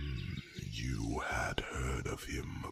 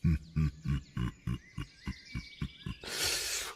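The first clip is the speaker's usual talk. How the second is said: long, slow, breathy chuckle; evenly spaced, husky creature laugh; dragon chuckle; 3 dB louder than usual. Acted. Dragon chuckles on greeting friend.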